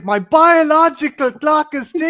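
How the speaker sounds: hammed-up biological-clock nag; loud, high mock whine of an impatient fiancée; mock Marisa Tomei. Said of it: "And Marissa Tomei is".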